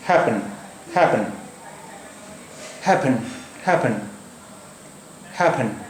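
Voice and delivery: हैपन with one short p, softly; careful demo. Shown as a demonstration. Teacher repeating the word for students. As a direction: repeat हैपन slowly, single p. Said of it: "तीसरा word हम लेते हैं एक".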